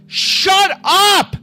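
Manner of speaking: exasperated imitated outburst; mock inner shout. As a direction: yell it in your head at her. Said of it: "I'm like".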